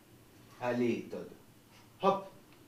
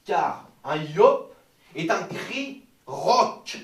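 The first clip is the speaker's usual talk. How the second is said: slow emphatic declaiming; 6 dB louder, much slower than usual. Shown as a demonstration. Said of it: "teacher declaiming the yawp".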